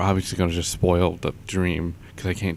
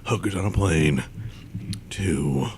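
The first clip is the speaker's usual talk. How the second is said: mock whisper. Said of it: "I'm generally always gonna be, like".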